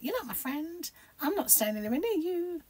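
high-pitched playful voice for squabbling hair; huffy hair strands. Pretend.

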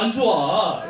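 ordinary talk, nothing marked

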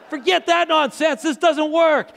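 angry retort. Retold or imitated. Pastor fed up with his ruined door panel, angry outburst. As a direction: shout down the inner voice in frustration. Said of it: "shock again and denial and anger".

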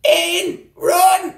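loud, high cry to run; panicked warning yell; urgent shout. Real, not acted. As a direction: scream at him to run. Warning a friend.

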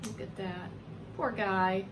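plain reading voice

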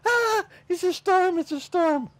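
high, slow mock panic; freaking out. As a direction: wail about the storm. Disciples freaking out.

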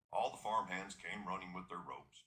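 usual speaking voice

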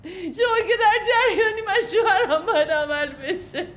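sobbing through the words, begging; tearful pleading. Acted. Woman begging the clerk for her lost bag, sobbing.

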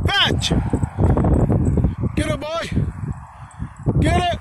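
high, eager fetch command; excited dog command. Real, not acted. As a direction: fire the dog up to chase.

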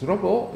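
"robot" as "ro-BO", no final t; French "robot" ending on an open O; French-style robot. Shown as a demonstration. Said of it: "it's said only by French people".

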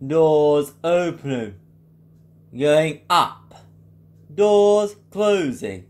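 slow, drawn-out lift announcement; older Ecodisc voice. Shown as a demonstration. Impressionist demos the older Ecodisc lift, slow announcer voice. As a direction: announce like an old elevator recording, words stretched out. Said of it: "let's start with the older Ecodisc voice".

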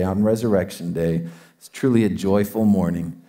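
prayer. Real, not acted. Pastor leads prayer.

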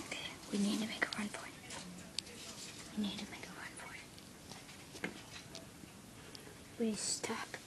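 fast hushed whisper. Acted. To his friend before the run, a whisper. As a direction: whisper the plan quickly.

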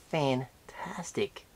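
slow breathy whisper. Real, not acted. Collector admiring the toy, slow whisper. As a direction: breathe it out in awe.

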